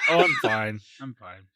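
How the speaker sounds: lightly mimicking the co-host's voice; Wes impression. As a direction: copy his co-host's delivery, loosely. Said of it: "Just doing my best you impression".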